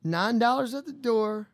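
slow, drawn-out local radio ad read; mock event announcement. Host plugging a dance to listeners, slow ad voice. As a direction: announce it like a small-town ad.

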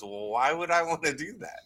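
a timid little squeak of a question; squeaky reply. Retold or imitated. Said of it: "The only thing I could squeak out was".

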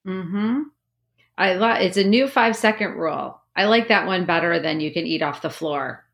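ordinary delivery

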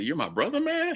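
the last word leaps very high, in retold shock; disbelieving question. To the new half brother, a high incredulous retold question. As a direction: let man fly up in stunned disbelief.

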